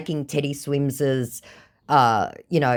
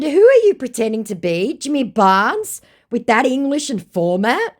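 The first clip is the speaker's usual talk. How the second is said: higher, louder sceptical questions; mocking challenge; 10 semitones higher, 6 dB louder than usual. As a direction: call out the fake.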